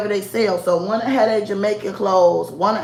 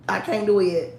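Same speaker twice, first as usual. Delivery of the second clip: ordinary talk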